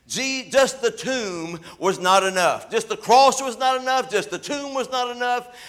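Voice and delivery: high, ringing preacher's delivery; full-force preaching. Real, not acted.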